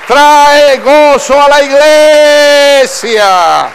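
loud, high, slow climax; preaching at full force. Acted. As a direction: build to a shouting climax. (clímax de predicación, fuerte y lento)

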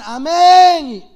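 long-drawn, loud amen; congregational amen. Acted. People praying for Juan answer, long loud amen. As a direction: draw out a big amen.

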